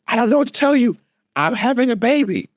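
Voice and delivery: high-pitched mock labouring wife; high, strained mock panic. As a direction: imitate a wife straining through a phone call.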